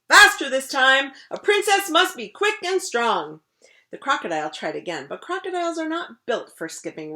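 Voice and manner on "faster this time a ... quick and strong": loud, high barked orders; commanding shout. Acted. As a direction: push hard like a coach.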